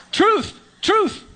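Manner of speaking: loud laughing protest. Real, not acted. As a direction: insist through a laugh. Laughing insistence.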